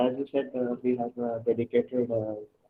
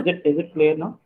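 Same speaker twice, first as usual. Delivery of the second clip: louder, checking the mic; speaking louder; 4 semitones higher, 7 dB louder than usual. Real, not acted. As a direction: raise your voice to be heard. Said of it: "be a bit louder?".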